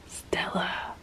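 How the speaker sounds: soft whispered name; hushed whispered call. Real, not acted. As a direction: call her name under your breath. Calling cat softly.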